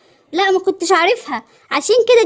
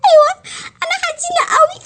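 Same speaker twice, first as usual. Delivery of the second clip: high pretend weeping; sad, crying mermaid; 7 semitones higher than usual. Mermaid admits her sorrow to the dog, crying. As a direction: whine it high and break into mock sobs. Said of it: "مش هتعيطي تاني".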